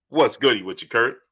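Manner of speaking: ordinary talk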